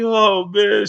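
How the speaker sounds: put-on a kid knowing he's in trouble; kid groaning. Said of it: "I'm like".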